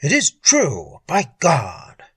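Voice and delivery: slow, emphatic astonishment; amazed, weighty confirmation; astonished exclamation. A narrator's reading. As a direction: exclaim it slowly, stunned by the revelation. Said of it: "The revelation brought Harper to his feet".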